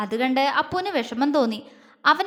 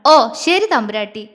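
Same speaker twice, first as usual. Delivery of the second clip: louder, mock-deferential teasing; bow to her like a little princess, teasing; 8 dB louder than usual. A narrator's reading. Mother teasing daughter who refuses dosa, loud mocking. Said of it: "ചിത്തിര അവളെ കളിയാക്കി".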